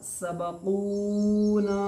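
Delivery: सबकूना with the vowels held long and slow; drawn-out Quranic reading. Shown as a demonstration. Teacher showing a student how to recite, stretching सबकूना. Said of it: "कैसे पढ़ेंगे?".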